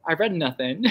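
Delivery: ordinary delivery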